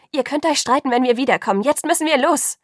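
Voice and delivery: high, loud, urgent; impatient shout. A narrator's reading. Bella hurries Jacob and Alice along, shouting impatiently. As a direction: call out over both of them impatiently. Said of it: "rief ich beiden ungeduldig zu".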